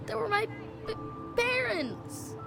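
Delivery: high, grief-stricken protest; anguished stammer. Acted. Son to his parents' killer, anguished. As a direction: stumble on the word, then wail it. Orphaned boy confronts killer.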